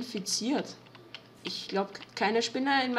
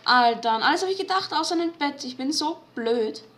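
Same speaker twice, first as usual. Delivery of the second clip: frustrated rant; 5 semitones higher, 6 dB louder than usual. Real, not acted. Self-scolding rant.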